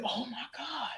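quiet, shaken "oh my God"; stunned whisper. Retold or imitated. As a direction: whisper it as it all sinks in. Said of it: "I said".

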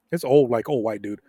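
ordinary delivery

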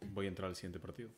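low, hushed and grave; solemn tone. Acted. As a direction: speak it like a solemn announcement. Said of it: "Ahora con este tono solemne".

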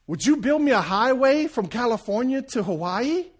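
high, loud, hopeful ask; eager request. Acted. The guy asks the genie for his dream highway, high and eager. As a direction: beg for the highway.

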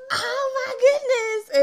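loud, high, drawn-out mock alarm; hammed-up cry of shock at the lump; retold panic. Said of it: "then I go into panic mode".

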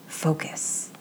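very soft whispered reminder; whispered self-command. A narrator's reading. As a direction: whisper it to steady yourself. Character telling herself to focus.

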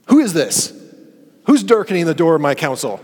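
loud, high, stern God voice; stern rebuke. Retold or imitated. God rebuking Job for questioning him, stern and loud. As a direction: thunder the challenge. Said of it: "read these words as God saying".

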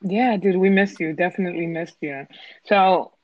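ordinary talk

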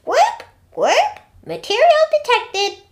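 very high, loud robotic announcement; computer alert voice. A narrator's reading. Computer's scan of the sand reports back, high alert voice. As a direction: squeak it out like a scanner alert. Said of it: "a voice came from her".

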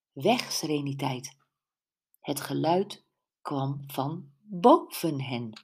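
plain reading voice

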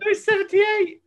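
high laughing correction. Real, not acted. Laughing while correcting a friend's sum.

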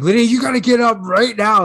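loud, high-pitched frantic order; reenacted parental outburst; exasperated dad yelling. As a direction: yell at your kid at the end of your rope. Reenacts losing temper at daughter.

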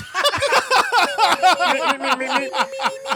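high squeaky mimimi laugh; Beaker meep. Retold or imitated. Friends mocking Beaker lookalike.

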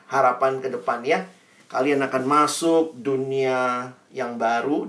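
ordinary delivery

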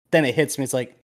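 usual speaking voice

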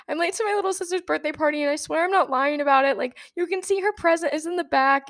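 fast, high, breathless babble of excuses; talking over herself to a traffic cop, nervously rambling; panicked rambling. Retold or imitated. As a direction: rush the words out in a nervous panic. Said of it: "And I just start rambling".

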